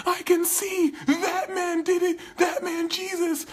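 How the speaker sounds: very high, loud sobbing laugh of joy; overwhelmed healed man; laughing crying joy. Retold or imitated. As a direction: blurt it out laughing and crying at once. Healed man leaves, overjoyed.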